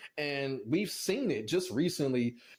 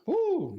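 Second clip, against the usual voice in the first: high, loud disbelieving whoop; a shocked yelp of disbelief; incredulous whoop; 5 dB louder than usual. Real, not acted. Reacting to a dumb tweet.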